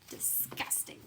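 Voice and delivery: breathless sneer; whispered disgust. Acted. Lily sneering at her doting parents, whispered. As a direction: hiss it under your breath.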